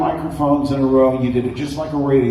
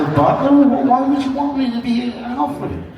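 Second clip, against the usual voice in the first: incredulous; 10 semitones higher than usual. Retold or imitated. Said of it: "I said".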